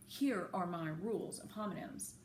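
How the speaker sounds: ordinary delivery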